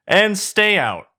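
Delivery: high, loud, drawn-out shout; shouted send-off. Acted. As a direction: chase them off, stretching the words. Shrek chases the men off.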